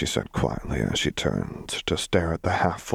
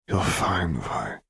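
quiet reassurance; 3 dB quieter, slower than usual. A narrator's reading. Caitlin comforting a crying Vi, quietly. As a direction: reassure gently under the breath. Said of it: "Caitlin said quietly".

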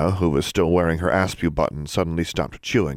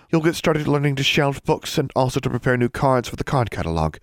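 higher-pitched lady librarian voice; prim librarian; 7 semitones higher than usual. A narrator's reading. Librarian assigning a student's detention work, prim high voice. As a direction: give orders as a librarian.

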